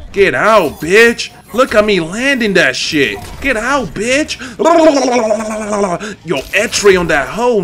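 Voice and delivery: loud, high-pitched hype; too hyped. Real, not acted. Gamer landing his combo on an opponent, loudly hyped. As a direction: crow over the move as it lands. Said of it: "We got a little too hyped there".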